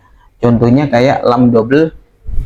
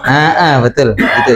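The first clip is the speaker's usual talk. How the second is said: ordinary talk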